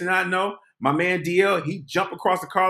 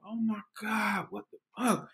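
stunned mutter; 4 semitones higher, 10 dB quieter than usual. Retold or imitated. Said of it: "I'm like".

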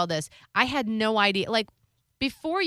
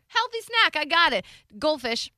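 high eager kid voice, mimicking daughter; child voice; 7 semitones higher than usual. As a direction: chirp it like a proud seven-year-old. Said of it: "So she's like".

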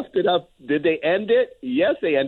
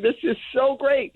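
mock delight; 8 semitones higher than usual. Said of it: "and you're saying".

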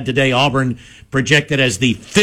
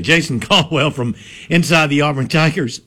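normal talking voice

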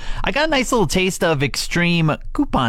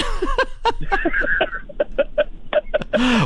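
high-pitched burst of laughter; big high laugh. Real, not acted. Host laughs.

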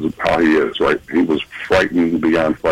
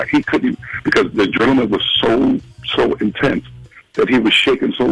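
ordinary talk

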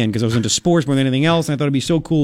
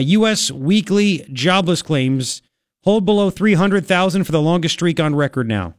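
ordinary talk, nothing marked

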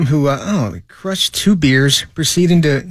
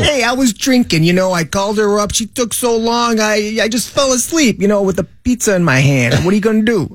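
higher, hammed-up Chicago guy; Chicago accent; 5 semitones higher than usual. Drunk man excusing himself for falling asleep, Chicago accent. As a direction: plead your case in a put-on Chicago accent. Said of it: "This is a Chicago accent".

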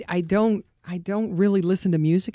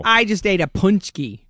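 ordinary talk

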